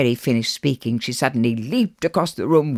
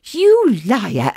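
high, loud retort; indignant accusation; 10 semitones higher, 6 dB louder than usual. Acted. Mary accuses Alice, loud and indignant. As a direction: burst out accusingly.

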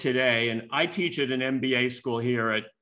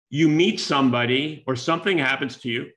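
ordinary delivery

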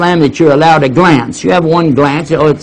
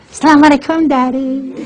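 high, sugary greeting; sweet daughters; 13 semitones higher than usual. Retold or imitated. Daughters greeting their father all innocent, high sweet voice. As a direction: innocent as can be. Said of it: "they're gonna say".

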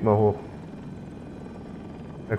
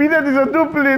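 shouting orders over battle; 13 semitones higher than usual. Real, not acted. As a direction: yell the name in outrage. Excited outburst about enemy player.

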